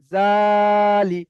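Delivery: first syllable of "জালি" held very long, then short li; drawn-out syllable. Shown as a demonstration. Teacher joins the letters for students, jaa of "জালি" stretched long. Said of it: "টান দিয়ে পড়তে হবে".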